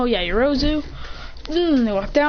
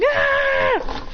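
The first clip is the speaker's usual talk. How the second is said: high-pitched fleeing shriek; panicked mock scream; 14 semitones higher than usual. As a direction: scream as if running from a grenade. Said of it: "they all run going".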